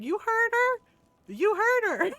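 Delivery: high, loud retold taunt; gleeful rubbing-in. Sibling backing mom's scolding to brother, high loud taunt.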